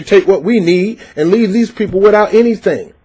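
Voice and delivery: ordinary delivery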